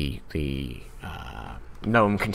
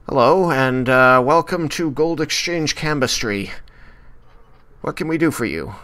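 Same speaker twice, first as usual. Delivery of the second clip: higher, louder welcoming shopkeeper; cheerful tiefling clerk; 7 semitones higher, 5 dB louder than usual. Acted. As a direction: greet the customers brightly, pitched up. Tiefling greets customers.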